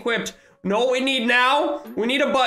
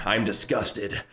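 snooty rich player; 8 semitones lower than usual. Retold or imitated. Streamer imagining the pet owner, mock snooty and lower. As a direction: sneer it like a smug show-off. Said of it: "he's like".

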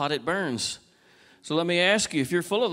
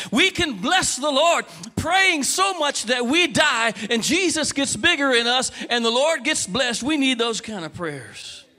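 high, loud, slow drawn-out climax; preaching at full force; 6 semitones higher, 4 dB louder than usual. Acted. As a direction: stretch every word to the peak.

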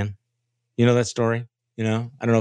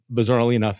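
ordinary delivery

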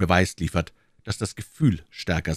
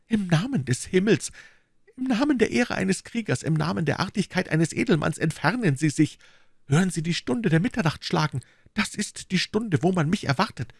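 put-on lightly raised, pleading young woman, a touch urgent; 12 semitones higher than usual. A narrator's reading. Young woman begging the suitor to go, pleading. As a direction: beg him by heaven and honour to leave her now. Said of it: "flehend und die Hände ringend".

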